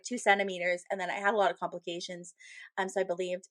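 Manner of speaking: normal talking voice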